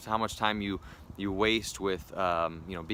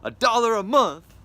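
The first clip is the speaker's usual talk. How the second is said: much higher, much louder TV pitchman yell; crazy infomercial voice; 13 semitones higher, 10 dB louder than usual. Shown as a demonstration. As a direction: hype it like a late-night infomercial host. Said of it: "this sounds like a crazy infomercial".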